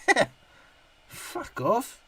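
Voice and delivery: ordinary talk, nothing marked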